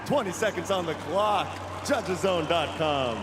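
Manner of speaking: ad read. Acted. Sponsor read on clock.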